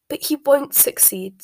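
an acted sob of despair; sobbing. A narrator's reading. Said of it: "sobbed Narcissa".

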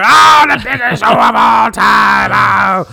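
slow, high, shouted hype call; crazed announcer. Retold or imitated. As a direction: yell it like a frantic ring announcer. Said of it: "Vince McMahon is going crazy in the voiceover".

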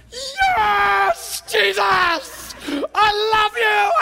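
high, loud ecstatic scream; screaming in joy. Retold or imitated. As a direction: scream your love to the sky, words stretched out. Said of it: "And I was screaming".

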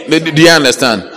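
normal talking voice

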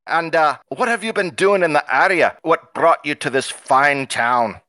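put-on cranky dwarf with a hammy Scottish accent; bad Scottish accent. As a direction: greet the laddies in loud, badly faked Scottish. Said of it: "cranky dwarf with red hair that speaks in a bad Scottish accent".

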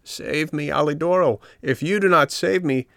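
high, pleading cry for help; feeble entreating voice. A narrator's reading. Little puppet begging the dog Alidoro for rescue, high and pleading. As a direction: beg desperately to be saved. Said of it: "a little feeble voice ... saying entreatingly".

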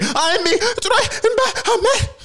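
mock her shrill overblown fear with a laugh; mocking her panic. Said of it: "Did y'all hear how the bitch elevated?".

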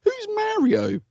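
very high-pitched puzzled question; imitated clueless aunt. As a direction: mimic a baffled aunt in a squeaky voice. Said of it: "And she said".

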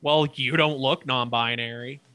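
ordinary talk

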